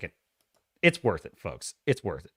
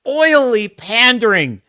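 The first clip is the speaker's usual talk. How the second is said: normal talking voice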